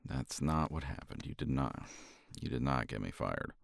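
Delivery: usual speaking voice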